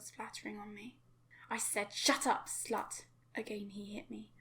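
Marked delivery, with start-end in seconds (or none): 1.5-3.0 loud barked command; angry shout. Acted. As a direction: roar it to silence her. Father screams at daughter.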